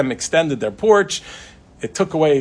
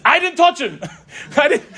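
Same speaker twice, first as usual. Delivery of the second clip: panicked denial; 13 semitones higher, 5 dB louder than usual. Retold or imitated. Man panics at screaming mother.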